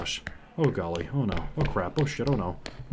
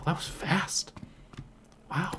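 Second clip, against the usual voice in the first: whispered; 8 semitones higher, much slower than usual. Real, not acted. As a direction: murmur it so nothing hears. Whispers to avoid monster.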